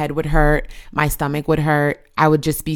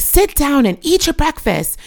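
high mock order; retold yell; 12 semitones higher, 3 dB louder than usual. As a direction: order the boy back to the table, raised. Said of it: "I'd be like".

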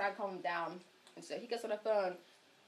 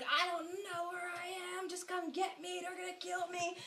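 mock panicked plea; 7 semitones higher, 6 dB quieter than usual. Tripping friend begs police dispatcher to come get him, mock panicked plea. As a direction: imitate a lost caller begging, voice pitched up. Acting out paranoid caller to dispatcher.

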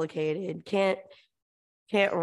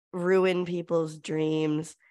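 ordinary delivery

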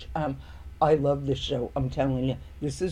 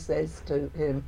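usual speaking voice

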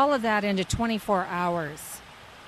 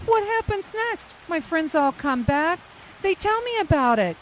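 normal talking voice

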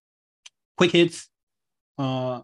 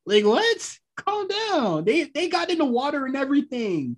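incredulous; 12 semitones higher than usual. Acted. Mocking overreacting critics.

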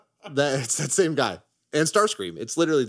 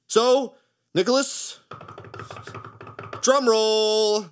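announcer voice; 11 semitones higher, 4 dB louder, much slower than usual. Real, not acted. Announcing picks.

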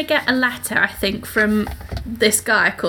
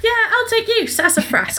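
smarmy suitor; 11 semitones higher than usual. Retold or imitated. Voicing Collins proposing to Lizzie.

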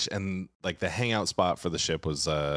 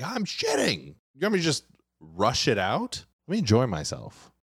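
indignant reply; 7 semitones higher than usual. Retold or imitated. Yelling back from the toilet stall.

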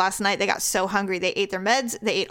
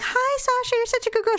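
much higher sing-song coo to the cat; cooing pet talk; 14 semitones higher than usual. Real, not acted. Owners greet their cat in the car, high cooing. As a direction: sweet-talk a sulking cat, pitched way up.

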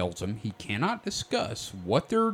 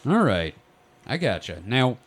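ordinary delivery

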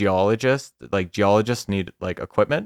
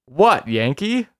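slow, higher mock Polish accent; terrible Polish accent; 6 semitones higher, 4 dB louder, slower than usual. Storyteller quoting the man calling to the craft, bad Polish accent. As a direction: call out in a badly faked Polish accent. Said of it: "your terrible Polish accent".

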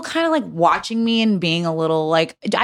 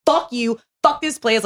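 higher, heated parting shot; retold quitting outburst, raised pitch; storming-out curse; 7 semitones higher, slower than usual. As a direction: throw it over your shoulder as you quit. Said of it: "slammed it on the bar".

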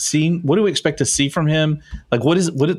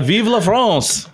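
mock French cry, slow; Vive la France; 6 semitones higher, slower than usual. Broadcaster mocks a French rally cry to his partner. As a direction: burst out a hammy French cheer. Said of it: "you can burst out".

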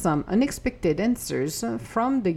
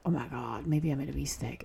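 much softer, worried whisper; anxious whisper; 5 semitones lower, 9 dB quieter, faster than usual. Retold or imitated. The ego doubting a choice, anxious whisper. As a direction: whisper the doubt under your breath. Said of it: "you listen to your ego and you're like".

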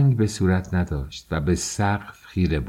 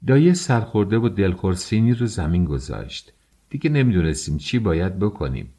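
ordinary delivery, nothing marked

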